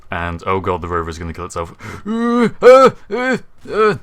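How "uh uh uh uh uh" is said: high, loud panicked stammering. Real, not acted. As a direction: panic as the rover crashes. Rover about to crash.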